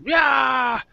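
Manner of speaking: high, loud mock outburst; imitated angry yelling. Imitating everyone at the bar yelling at him, angry. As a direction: yell like an imitated crowd going crazy. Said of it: "going crazy, being angry".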